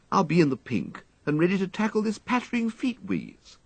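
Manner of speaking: eagerly recounting a play to his valet; breezy wistful gentleman; chatty young toff. A narrator's reading. Wooster's voice in story.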